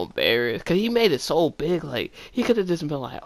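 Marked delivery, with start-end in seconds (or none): none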